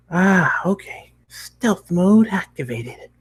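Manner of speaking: high, slow mock-secret agent; a pony hamming up going undercover; playful pony. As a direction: announce it like a spy game. Pony plays at stealth.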